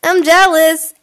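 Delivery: loud, high jealous cry; hammed-up jealousy; mock jealous outburst. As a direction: blurt out jealous. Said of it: "I was about to say, like".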